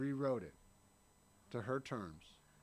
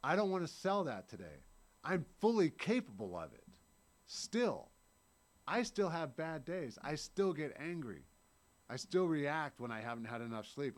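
normal talking voice

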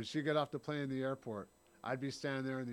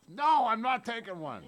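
much higher, much louder whiny refusal, laughing through it; toddler tantrum; 8 semitones higher, 11 dB louder than usual. Retold or imitated. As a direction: play a two-year-old refusing his nap. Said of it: "like a child with a temper tantrum".

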